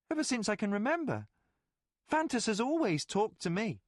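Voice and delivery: put-on a boy shrugging it off; boy William. William telling Colgan casually in a high boy voice.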